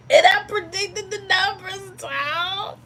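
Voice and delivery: high, crying cry of disbelief; emotional scream. Real, not acted. Host screaming to her stream about missing the win, crying out. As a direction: wail it out as the near-miss stings. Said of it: "I'm not gonna scream anymore".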